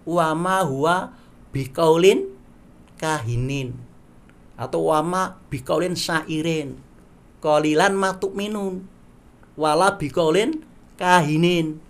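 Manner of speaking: slow measured recitation of Arabic verse; Quran recitation. Acted. Teacher reciting verses to the class, slow and measured. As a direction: recite each clause deliberately.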